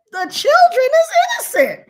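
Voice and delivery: high, loud protest; impassioned, high-pitched objection; indignant outcry. Retold or imitated. Indignant retelling of her objection.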